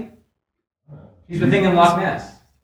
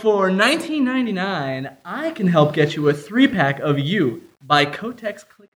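mock commercial read; 2 semitones higher than usual. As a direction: sell it like a TV ad. Improv sketch fake tampon ad.

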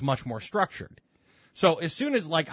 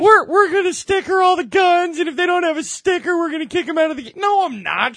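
much higher, louder parody voice; mocking coworker; 14 semitones higher, 9 dB louder than usual. Mocking coworker's sticker plan, high loud parody. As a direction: ham up the overeager coworker. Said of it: "mocking the coworker's proposal".

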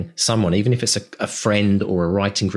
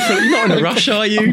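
much higher and louder, laughing through it; mock-needy plea not to leave; hammed-up desperate host; clingy host; 12 semitones higher, 5 dB louder than usual. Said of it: "You go".

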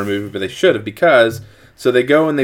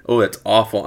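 ordinary talk

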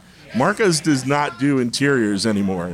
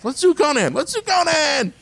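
high, eager cheer; eager shout; 14 semitones higher than usual. Retold or imitated. Artist's enthusiastic reply, retold.

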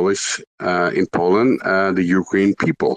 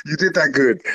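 laughing okay; 7 semitones higher, slower than usual. Real, not acted. Laughs at friend's joke.

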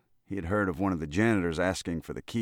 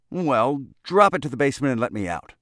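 higher, louder, fast sharp order; sharp command; 6 semitones higher, 7 dB louder than usual. Acted. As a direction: snap the order out quickly and loudly. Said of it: "said Mister Ludlow sharply".

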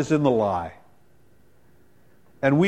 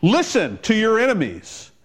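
full-force preaching; 8 semitones higher than usual. Acted. Preacher rallying the congregation, fervent preaching. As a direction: build to a fervent peak.

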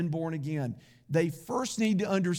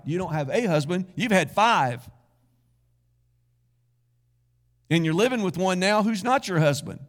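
louder and fast, pressing each fact; firm declaration; 6 dB louder than usual. Retold or imitated. Jesus confronting the woman about her five husbands, louder and firm. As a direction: lay out the truth with rising force. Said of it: "Jesus said".

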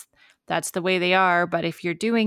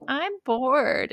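higher, drawn-out kid complaint, lightly mimicked; lightly voiced child; 7 semitones higher than usual. Parent imitating her five-year-old complaining, lightly voiced child. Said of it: "my five-year-old has started doing this thing where she's like".

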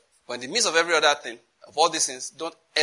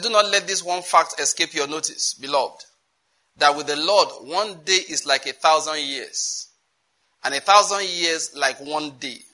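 slow, loud, measured Bible reading; scripture reading; 5 dB louder than usual. Real, not acted. Preacher reading Peter's word to the beloved, slow emphatic scripture reading. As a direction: proclaim the verse with weight, phrase by phrase.

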